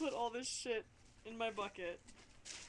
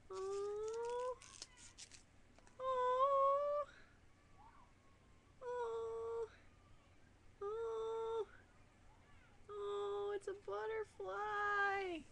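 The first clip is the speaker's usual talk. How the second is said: awed little ohs; 10 semitones higher, much slower than usual. Real, not acted. Opening a cute collage.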